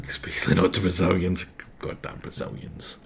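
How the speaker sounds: hushed, fast whispered aside. Real, not acted. Joking aside to the audience, whispered. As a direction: lean in and whisper the jab.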